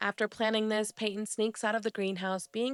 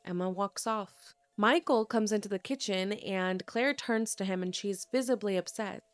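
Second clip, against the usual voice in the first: usual speaking voice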